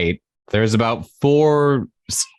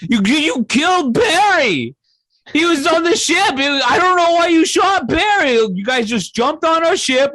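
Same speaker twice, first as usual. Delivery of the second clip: high, squeaky outraged skeleton voice, shouting at times; indignant skeleton. Acted. Skeleton scolding the party for killing Barry, high squeaky outrage. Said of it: "The skeleton turns to you and go".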